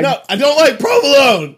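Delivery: high, loud petulant yell; shouting. Acted. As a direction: diva snapping at the crew. Yelling at imaginary assistant.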